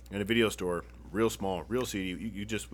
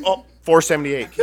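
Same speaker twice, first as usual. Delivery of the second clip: mom's confident answer; 5 semitones higher, 11 dB louder than usual. Retold or imitated. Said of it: "She's like".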